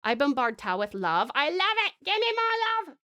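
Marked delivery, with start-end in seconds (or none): 1.3-3.0 gushing. Acted. Greedy joke about love.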